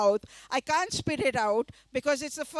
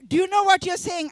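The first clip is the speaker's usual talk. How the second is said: shocked, raised voice; 7 semitones higher, 8 dB louder than usual. Retold or imitated. Teacher shocked at the phrase.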